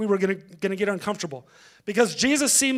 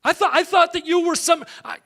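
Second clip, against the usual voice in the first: high, raised, mock indignation; offended outburst; 6 semitones higher than usual. Offended person confronting Jesus, indignant outburst.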